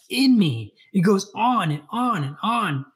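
awed rising intensity. A narrator's reading. Paul's awakening, voice rising.

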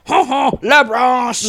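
loud, drawn-out mock French; triumphant cartoon Frenchman, slow and loud; French accent. Census man renaming the German, hammed-up French accent. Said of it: "the guy is like".

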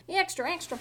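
newsboy call. Pretend. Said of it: "The same guy that said extra, extra".

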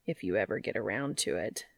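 low wry tease; low teasing Cass. Acted. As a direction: tease him low and dry.

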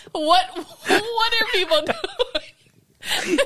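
high, slow, breaking into laughter; laughing so hard the words stretch out; helpless laughter. Real, not acted. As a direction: squeeze the words out while laughing. Laughing too hard to tell it.

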